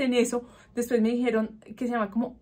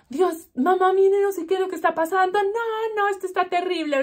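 high, hurried, hammed-up panic; panicked plea; 8 semitones higher than usual. Daughter crying out to her mother over burning fingers, panicked plea. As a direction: wail to mum in a rush, replaying the panic.

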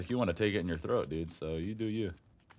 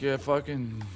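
ordinary talk, nothing marked

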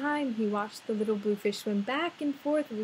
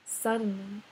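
usual speaking voice

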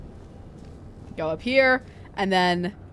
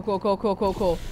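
normal talking voice

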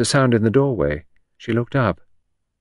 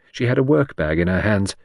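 usual speaking voice

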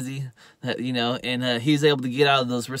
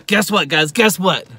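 louder, raised build-up; excited tease; 5 semitones higher, 6 dB louder than usual. Real, not acted. Storyteller about to reveal who turns up, excited. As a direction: announce a big reveal to the listeners.

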